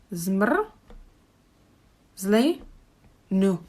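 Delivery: zmr, zli, nu split into three slow syllables. Shown as a demonstration. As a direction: break zmrzlinu into zmr, zli, nu. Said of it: "Three syllables".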